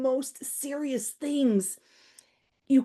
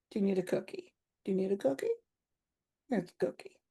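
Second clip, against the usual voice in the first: soothing offer; 5 semitones lower, 9 dB quieter, faster than usual. Pretend. Soothing offer to upset stranger.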